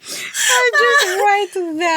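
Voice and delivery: high, drawn-out pretend crying; mock tearful wail. Podcast guest showing cohost her hair-trigger tears, mock tearful. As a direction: wail it as if tears are right there. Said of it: "my tears are just right".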